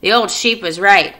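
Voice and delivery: loud, gleeful rat voice; gloating rat. Acted. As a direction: relish every treat. Rat gloats about fair.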